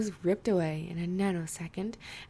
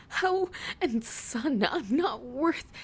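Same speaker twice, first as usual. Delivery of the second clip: choked crying; 6 semitones higher, 4 dB louder, slower than usual. Acted. Lily crying.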